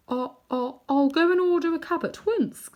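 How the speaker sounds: high, flustered stammer; gasping. A narrator's reading. Uncle Andrew gasping his obedience to the witch. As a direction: scramble to obey. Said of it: "gasped Uncle Andrew".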